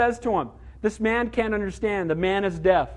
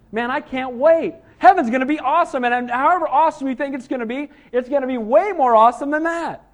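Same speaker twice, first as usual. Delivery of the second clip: louder, higher, thrilled preaching; excited anticipation; 6 semitones higher, 5 dB louder than usual. Real, not acted. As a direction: burst out with eager longing for heaven. Preacher eager for heaven.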